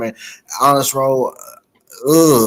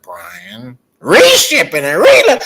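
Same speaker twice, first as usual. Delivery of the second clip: 1 creepy surgeon; 6 semitones higher, 7 dB louder, slower than usual. Retold or imitated.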